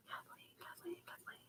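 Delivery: breathy whispered pleading of a name; exasperated whisper. Retold or imitated. Teen retelling reply to strict aunt, exasperated whisper. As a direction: whisper her name in defeat. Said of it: "I'm like".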